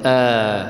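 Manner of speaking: آ drawn out as a sustained "aa"; long madd vowel. Shown as a demonstration. Teacher demonstrating the alif of madd, long held "aa". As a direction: hold آ long and even. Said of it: "من جنس حركته".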